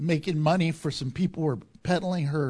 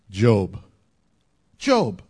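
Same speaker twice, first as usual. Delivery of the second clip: emphatic answer; 11 semitones lower, 5 dB louder than usual. Real, not acted. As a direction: land the name hard and loud. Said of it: "emphatic answer, repeated".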